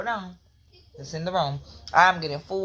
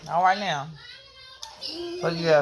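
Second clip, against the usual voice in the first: ordinary talk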